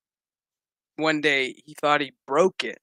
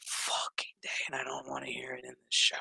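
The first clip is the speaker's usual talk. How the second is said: fast annoyed whisper; 3 semitones lower, 10 dB quieter than usual. Real, not acted. Annoyed aside about preachy show.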